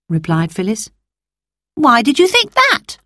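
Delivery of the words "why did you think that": put-on boy voice. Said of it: "asked Peter".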